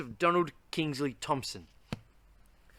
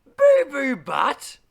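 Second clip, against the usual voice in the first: slow, loud, much higher questioning cry; outraged disbelief; 7 semitones higher, 10 dB louder, slower than usual. Acted. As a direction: repeat the name as if it's an insult. Repeats silly name in disbelief.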